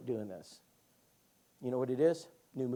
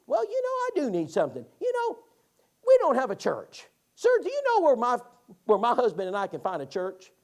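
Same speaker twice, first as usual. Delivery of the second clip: high, loud put-on woman's plea; mock female voice, high and insistent; woman at the door; 8 semitones higher, 5 dB louder than usual. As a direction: act out a lady asking for help, pitched up. Retold woman asking for a church.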